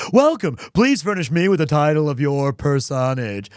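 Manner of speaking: hard-sell the welcome to a customer; Stan the pitchman. Pretend. Streamer demos the Stan voice for the poll, loud pitch. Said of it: "So it goes like this".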